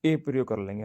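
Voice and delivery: usual speaking voice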